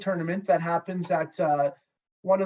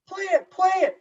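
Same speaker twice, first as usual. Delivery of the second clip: mock call out; 11 semitones higher, 3 dB louder than usual. Defenceman calling out on ice.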